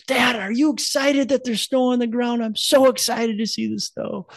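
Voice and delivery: put-on high, excited kid's voice, slowed; excited little boy voice. Dad imitating his son thrilled about snow, high and excited. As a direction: chirp it like a thrilled small son.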